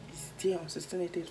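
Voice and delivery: fast, hushed whisper; quick whispered aside to the camera. Real, not acted. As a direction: slip it out under her breath, quickly. Aside while filming.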